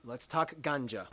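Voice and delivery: low and slow, teasing; slow dramatic reveal. Real, not acted. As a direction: draw it out with a wink.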